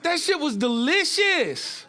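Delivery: high, slow, mock-angry complaint; mock mad. Comic mock-furious at roommate over delicious Pop-Tart, high and slow. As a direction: drag it out high in fake outrage. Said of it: "I was just mad at her".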